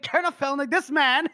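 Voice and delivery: high, urgent mock complaint; protesting guard. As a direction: burst in with a high-pitched report. Guard protesting to his colonel.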